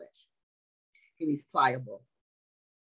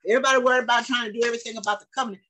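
rapid aside; 6 semitones higher, 6 dB louder, much faster than usual. Real, not acted.

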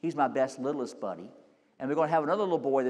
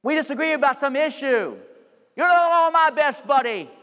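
higher and louder, mocking the childish line; preaching loud; 13 semitones higher, 11 dB louder than usual. Pastor scolding the congregation with his toddler's line. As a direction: shout the grown-up's petty grudge.

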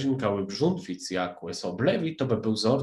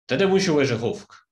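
loud, raised narrated cry; calling out; 5 semitones higher, 8 dB louder than usual. A narrator's reading. Hatter and March Hare demand the Dormouse, calling out. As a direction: call it out, louder and higher. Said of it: "krzyknęli Kubucznik i marcowi zając".

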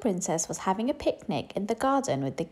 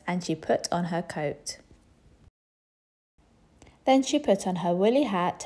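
usual speaking voice

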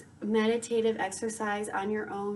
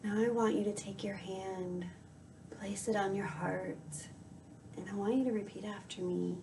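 guided meditation; 9 dB quieter than usual. Acted. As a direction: softly guide the listener into calm, unhurried.

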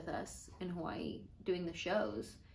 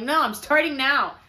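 loud, raised retold refusal; mock insistence; 6 semitones higher, 11 dB louder than usual. Refusing a friend's drink offer, loud mock insistence. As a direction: push back hard, playfully.

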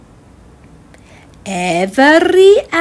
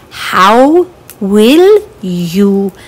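ordinary talk

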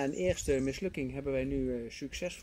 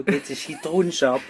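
loud correction; 9 dB louder than usual. Real, not acted. Loud correction of partner's joke.

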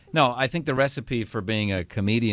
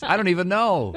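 announcer voice; 9 semitones higher, 4 dB louder than usual. Shown as a demonstration. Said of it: "This is my announcer voice".

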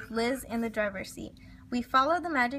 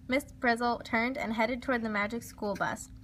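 plain reading voice

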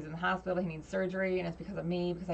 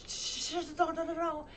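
whispered shushes into high-pitched don'ts; panicked hushing; 11 semitones higher than usual. Retold or imitated. Acting out his panicked reaction.

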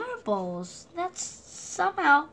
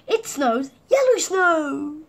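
yellow stressed, higher and louder; emphatic reveal; 4 semitones higher, 6 dB louder, slower than usual. A narrator's reading. Narrator surprising children with yellow snow, yellow stressed. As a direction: lean hard on yellow.

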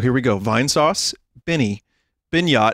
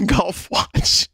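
pitched up, fighting real giggles; laughing; 6 semitones higher than usual. Real, not acted. Streamer reads own nickname list, laughing. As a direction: get the words out through a laugh. Cracks up reading silly nicknames.

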